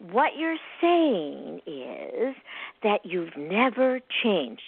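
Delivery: slow, deliberate restating; slow Socratic echo. Retold or imitated. To the huffy client, a slow deliberate echo of her words. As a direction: drag it out, mirroring her back. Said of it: "Socratic method".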